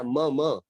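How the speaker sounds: म said with the full vowel, "म" as "मअ"; full म sound. Shown as a demonstration. Teacher sounds out म for the student who is typing. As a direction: sound out "म" clearly for the class. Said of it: "मम में म आ पूरा कर दीजिए".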